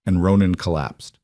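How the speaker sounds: ordinary delivery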